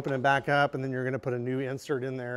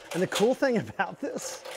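ordinary talk

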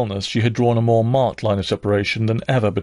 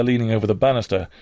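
ordinary delivery, nothing marked

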